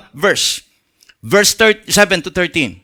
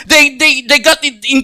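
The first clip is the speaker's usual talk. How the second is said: normal talking voice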